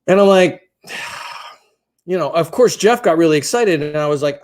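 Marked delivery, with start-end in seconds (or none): 0.9-1.5 long, soft exhale; wordless sigh of reluctance; reluctant sigh. Retold or imitated. Acts out his reluctant reaction.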